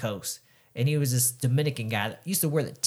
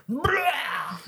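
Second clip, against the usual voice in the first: high-pitched vomiting noise; sudden cartoonish "blah" of throwing up; imitated retching. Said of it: "All of a sudden I hear".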